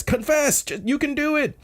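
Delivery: very high, squealed encouragement; urgent cheering. Retold or imitated. Voicing the friends' urging sign.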